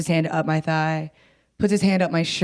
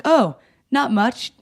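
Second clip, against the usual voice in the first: higher, drawn-out nonchalance; forced casual; 6 semitones higher, 4 dB louder, slower than usual. Retold or imitated. Cleaner answers concerned buddy, forcedly casual. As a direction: play it off as if all is fine.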